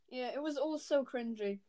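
ordinary delivery

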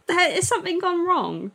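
high-pitched, giggly disbelief; baffled, laughing. Retold or imitated. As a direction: ask it incredulously through laughter. Retold baffled reaction, breaking into laughter.

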